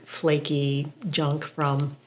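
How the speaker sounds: ordinary talk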